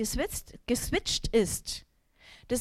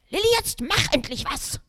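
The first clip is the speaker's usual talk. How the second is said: demanding call; 6 semitones higher, 5 dB louder than usual. Retold or imitated. Son demanding help at night.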